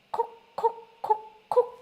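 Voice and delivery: short, high, separated pokes; high staccato jabs; jabbing demonstration. Shown as a demonstration. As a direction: poke out each syllable like a sharp jab. Demonstrating a repeated jabbing pain.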